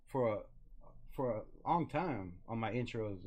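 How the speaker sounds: ordinary delivery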